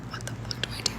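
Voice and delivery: barely audible retold whisper; whispered inner thought. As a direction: whisper it as a desperate private thought, no voice at all. Retells her panicked private thought.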